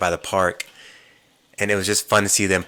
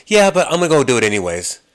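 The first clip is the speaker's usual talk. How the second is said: louder, fast, higher mock-stubborn insistence; defiant brush-off; 7 semitones higher, 7 dB louder, faster than usual. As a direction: rattle it off, doing it anyway. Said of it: "And he goes".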